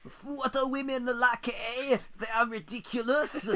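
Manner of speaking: very high, hammed-up French caricature; mock French person. French PM scorning women, absurdly high mock French voice. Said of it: "then the French person is like".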